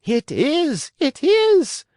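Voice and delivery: very high, loud joyful shriek. A narrator's reading. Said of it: "shrieked Signora Rosaura".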